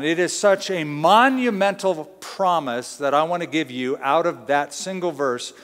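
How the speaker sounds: ordinary delivery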